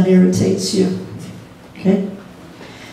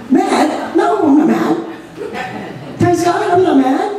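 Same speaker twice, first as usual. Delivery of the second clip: mock denial; 7 semitones higher than usual. Acted denial of being mad.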